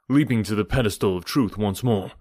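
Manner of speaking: ordinary delivery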